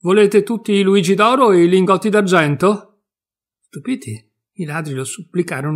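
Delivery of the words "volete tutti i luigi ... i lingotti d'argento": loud, high shout through the house; cheeky loud shout. A narrator's reading. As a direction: bellow it to wake everyone. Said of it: "si mise a gridare".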